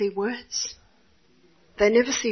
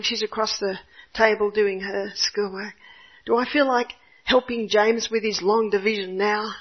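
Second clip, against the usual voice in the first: ordinary talk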